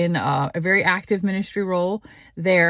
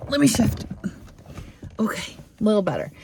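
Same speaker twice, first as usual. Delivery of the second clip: quick whispered aside; 3 semitones higher, faster than usual. Real, not acted. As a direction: whisper it to yourself. Adjusting camera, muttered aside.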